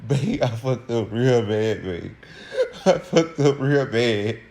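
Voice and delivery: slow, wailing fake sob; hammed-up tearful confession, words dragged out; imitated crying. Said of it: "he started crying".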